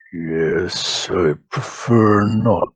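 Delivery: a lightly voiced orc priest; orc shaman voice. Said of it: "He speaks in Orcish and says".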